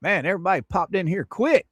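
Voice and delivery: normal talking voice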